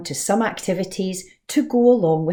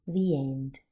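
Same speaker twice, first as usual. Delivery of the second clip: put-on lively rhyming read-aloud for children; story narration; 4 semitones higher than usual. A narrator's reading. Teacher reading a rhyming story to the class, lively storytime voice. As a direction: tell it bright and bouncy, like storytime.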